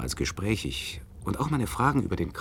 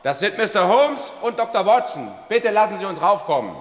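calling up; 11 semitones higher, 5 dB louder than usual. Acted. Doctor keeps calling upstairs.

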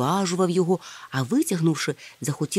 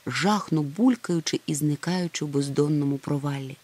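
ordinary delivery

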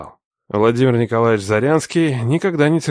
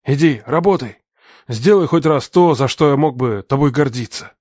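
higher, louder scornful order; harsh command; 5 semitones higher, 4 dB louder than usual. Acted. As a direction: drive him out. Father orders son out.

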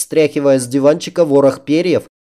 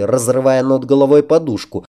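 usual speaking voice

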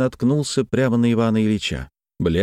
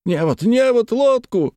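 high urgent cries; high-pitched shouted orders; shouting; 3 dB louder than usual. A narrator's reading. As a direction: call out for gear in alarm. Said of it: "кричали другие голоса".

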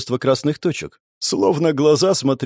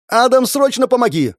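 loud high cry for help; girl screaming for help; 10 semitones higher, 4 dB louder than usual. Acted. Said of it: "Девичий крик".

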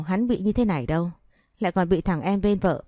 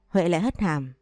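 ordinary delivery

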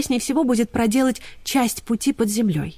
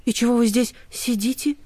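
surprised; 4 semitones higher than usual. Acted. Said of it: "Ксюня удивлённо".

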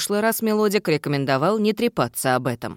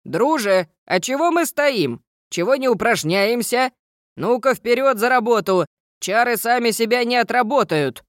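rally the class like a drill gnome; commanding gnome; 7 semitones higher than usual. Acted. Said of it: "скомандовал".

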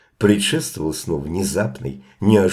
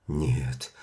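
soft, drowsy mumbled no; sleep mumble; 5 semitones lower, 7 dB quieter than usual. A narrator's reading. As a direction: mumble it half asleep. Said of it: "бормотал во сне Пит".

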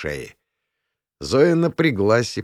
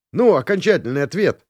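raised, lively quizmaster's call; exclaiming; 9 semitones higher, 4 dB louder than usual. A narrator's reading. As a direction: demand the final answer, pitched high. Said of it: "воскликнул Юрка". (громко восклицая)

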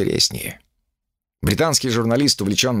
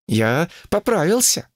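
higher cheeky monkey voice; higher, cheeky monkey king; 8 semitones higher than usual. A narrator's reading. Sun Wukong replies.

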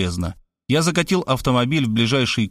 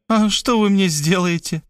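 laughing; 8 semitones higher than usual. Acted. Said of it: "рассмеялась она".

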